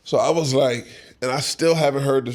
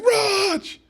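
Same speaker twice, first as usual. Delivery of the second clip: calling out. Retold or imitated. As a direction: shout his name across the room. Puff spots him at the door.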